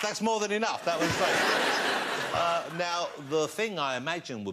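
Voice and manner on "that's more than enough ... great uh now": laughing hard as he speaks; laughing at the mix-up. Real, not acted. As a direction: push the words out through laughter. Laughing through the words.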